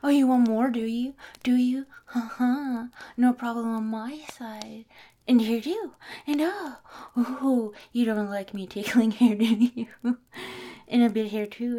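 loud, laughing playful teasing. Acted. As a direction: tease them while tickling. Teasing during a tickle fight.

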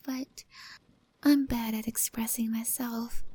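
intimate breathy roleplay whisper; hushed cat girl confiding close to the mic; ASMR whisper. Acted. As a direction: whisper shyly to your rescuer.

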